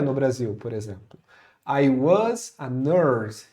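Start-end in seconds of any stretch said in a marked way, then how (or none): 1.7-3.5 slow, lower-pitched English example; slow model sentence. Shown as a demonstration. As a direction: space the words out for learners. Teacher models English sentence slowly.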